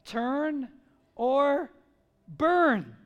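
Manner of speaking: slow, loud, spaced-out warning with pauses; hard pauses between each word. Retold or imitated. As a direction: bark it out like a street preacher. Peter's blunt warning, quoted.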